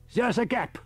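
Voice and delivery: put-on high, fussy elderly professor; a high old professor calling on the radio; professor's voice. A narrator's reading. Riker alarmed.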